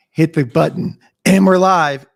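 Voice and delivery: loud, punchy show opener; hyped going-live intro. Acted. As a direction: launch the show with big energy. Podcaster acts out going live.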